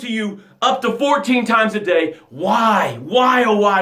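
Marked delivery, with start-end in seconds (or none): 2.3-3.8 shouted why, rising; fiery preacher's shouted question; rhetorical shout. Acted. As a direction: call it out to the crowd.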